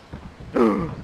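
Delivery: theatrical gasp of alarm. Acted. Prospero seeing sword drawn, mock-alarmed gasp. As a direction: gasp before calling for help.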